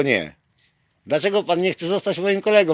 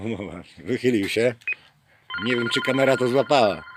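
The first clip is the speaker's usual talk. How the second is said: ordinary delivery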